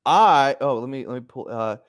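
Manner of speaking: ordinary delivery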